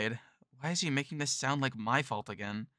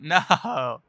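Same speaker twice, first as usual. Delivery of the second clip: laughing protest; 4 semitones higher, 8 dB louder than usual. Acted.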